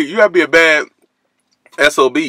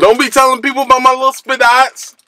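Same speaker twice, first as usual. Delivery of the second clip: mock outrage; 11 semitones higher than usual. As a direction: squeal it in playful indignation. Mock outrage over his spots.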